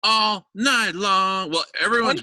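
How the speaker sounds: high, loud, very slow call; stretched announcer call. Real, not acted. Host hyping the all-night block party to a guest. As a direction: stretch it out like a party hype man.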